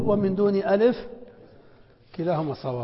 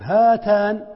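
slow, louder, each sound of هاتان stretched; drawn-out example word; 3 semitones higher, 7 dB louder, much slower than usual. Shown as a demonstration. Teacher to students, reading the example slowly and loudly. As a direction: spell هاتان out loud by stretching it. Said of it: "الألف محذوفة أو ثابتة؟".

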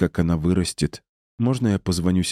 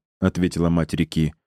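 ordinary delivery

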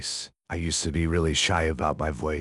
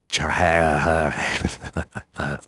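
bright bursting giggle; loud giggle; 5 dB louder than usual. Real, not acted. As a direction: giggle at yourself. Laughs after her impression.

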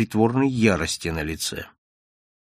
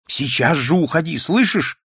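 angry; 4 semitones higher than usual. Acted. Nadya drives the man out.

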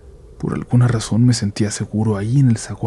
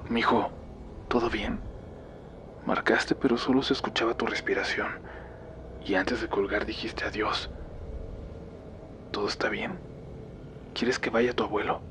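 hushed, gentle grandma on the phone; soft grandmother voice; 11 dB quieter than usual. Acted.

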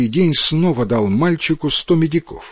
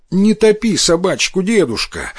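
pleading; 7 semitones higher than usual. A narrator's reading. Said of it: "взмолился Сабуро".

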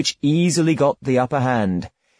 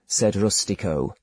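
ordinary delivery

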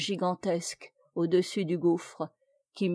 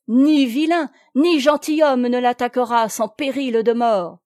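loud, high proclamation; resounding warning; 4 semitones higher, 8 dB louder than usual. A narrator's reading. Noël warning Plélan that Marguerite is protected, resounding warning. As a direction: declaim a solemn threat across the water.

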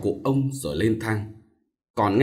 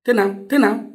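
high, louder, rapid-fire alarmed questions; urgent repeated questioning; 12 semitones higher, 4 dB louder than usual. A narrator's reading. Younger brother reacting to sister's news of a theft, urgent questioning. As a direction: press for an answer, pitch jumping up. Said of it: "Thấy em hỏi dồn dập".

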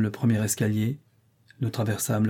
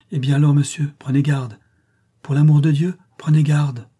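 raised, alarmed pleading; urgent warning; 4 semitones higher, 7 dB louder than usual. Acted. Grace begs her master to take care, in urgent warning. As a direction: plead loudly for caution, higher than usual. Grace warns visitors of the madwoman.

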